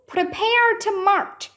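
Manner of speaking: high shouted order; shouted command. Acted. Centurion shouting the marching order. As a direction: call the march to the troops. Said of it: "the centurion shouted".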